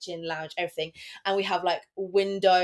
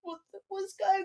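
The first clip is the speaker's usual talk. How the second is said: put-on high, softer child-like bewilderment; confused kids; 12 semitones higher, 6 dB quieter than usual. Host imitating kids woken by the alarm, high confused voice. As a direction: voice startled kids in a high, small voice.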